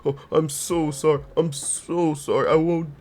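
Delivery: mock him as a guilty, upset man begging forgiveness; guilty whiny apology voice. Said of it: "I don't know why I gave him that voice".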